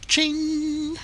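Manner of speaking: high bright ching sound; cash register ching. Pretend. Cash coming in.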